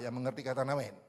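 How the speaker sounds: ordinary talk, nothing marked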